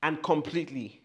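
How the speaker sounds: loud and high, rising; building, fervent shout; loud declaration. Shown as a demonstration. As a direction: declare it boldly. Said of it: "As loud as you can".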